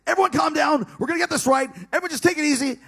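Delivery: loud, high call to settle everyone; anxious call. Retold or imitated. Calling on the team to calm down, loud and high.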